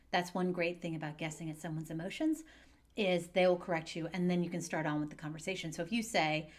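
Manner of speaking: ordinary talk, nothing marked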